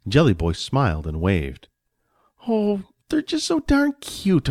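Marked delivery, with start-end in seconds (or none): 2.5-4.5 very high, drawn-out cooing; gushing. Acted. Wife sees Jelly Boy wave, gushing. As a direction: melt and coo, stretching it out slowly. Wife melts at the cute treat.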